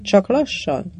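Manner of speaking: plain reading voice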